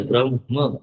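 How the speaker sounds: ब्रह्म said brahm with the h sounded before the m; correct Sanskrit pronunciation. Shown as a demonstration. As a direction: say ब्रह्म as br-h-m, breathy h before m, no final a. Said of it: "ह पहले". (ब्रह्म का शुद्ध उच्चारण, ह पहले)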